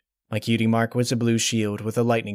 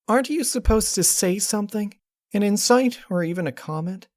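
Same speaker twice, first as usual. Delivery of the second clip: Twilight; 12 semitones higher than usual. Acted. Twilight after crying.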